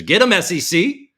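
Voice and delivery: loud, high gleeful cheer. Real, not acted. Streamer cheers as a short seller gets charged, gleeful. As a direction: cheer the regulators on.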